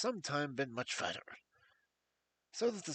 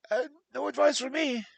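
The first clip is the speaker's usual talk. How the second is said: higher and louder, voice cracking; breaking voice; 10 semitones higher, 9 dB louder than usual. A narrator's reading. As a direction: cry out in desperation. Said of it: "cried Blessington in his breaking voice".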